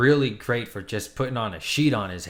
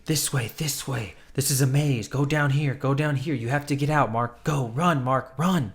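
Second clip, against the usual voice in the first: lightly raised, urgent divine guide; a hint of an urgent god voice calling him on; 4 semitones higher than usual. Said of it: "His god is speaking to him".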